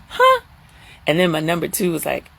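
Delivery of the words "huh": squeaky high disbelieving huh; a sudden high jolt of surprise; shocked huh. Retold or imitated. Her shocked reaction.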